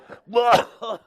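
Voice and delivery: high, loud burst of laughter; panicked laugh. Real, not acted. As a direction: laugh at your own panic. Laughs mid panic act.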